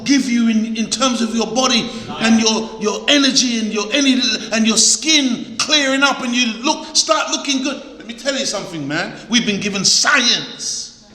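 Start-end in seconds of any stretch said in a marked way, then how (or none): none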